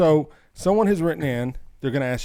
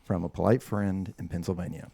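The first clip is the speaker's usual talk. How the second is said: 1 reading a letter aloud; 4 semitones lower than usual. Acted. Reads listener letter aloud.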